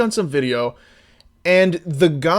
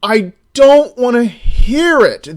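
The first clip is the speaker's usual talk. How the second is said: much higher, much louder exasperated yell, slowing at the end; yelling at porn; 10 semitones higher, 9 dB louder, much slower than usual. Retold or imitated. Yelling at a moaning porn actor, furious. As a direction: scream at the moaning guy to stop. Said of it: "and I'm like".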